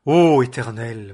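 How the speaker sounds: loud, slow cry to the Lord; crying out to God. A narrator's reading. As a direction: cry out to heaven in distress. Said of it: "et il cria à l'Éternel". (Élie crie vers l'Éternel, fort et lent)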